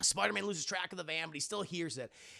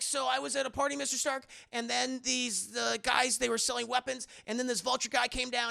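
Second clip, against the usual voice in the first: put-on higher-pitched eager kid reporting; Peter Parker; 6 semitones higher than usual. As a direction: imitate an earnest youngster explaining to a mentor. Peter reports to Mister Stark.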